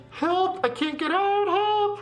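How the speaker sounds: high, desperate calls; high cry for help. Acted. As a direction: call for help, trapped. Trapped dancer calls out.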